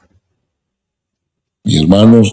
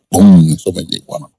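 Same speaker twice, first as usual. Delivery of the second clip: normal talking voice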